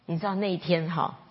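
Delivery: ordinary talk, nothing marked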